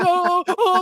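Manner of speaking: high, loud fake sobbing wail; hammed-up bawling; mock crying. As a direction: wail tearfully, well up in pitch. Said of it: "I'm, like, crying".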